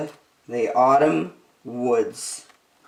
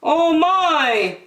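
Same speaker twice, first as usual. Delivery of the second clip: higher, a touch alarmed; dismayed bear; 11 semitones higher than usual. Acted. Bear looking up at the geese, mildly dismayed. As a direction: gasp it out in mild dismay. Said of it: "said Bear as he looked up high".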